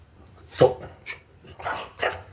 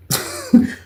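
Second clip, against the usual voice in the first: high burst of laughter. Real, not acted. Laughing at his own story.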